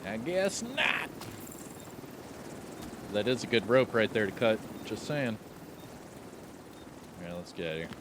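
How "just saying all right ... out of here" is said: ordinary talk, nothing marked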